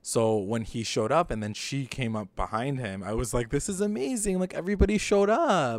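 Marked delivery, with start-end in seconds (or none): 3.5-5.8 high, delighted gush; thrilled. Retold or imitated. Fan gushing to her cohost about the cast return, high and thrilled. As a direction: squeal it like your favourite show just surprised you.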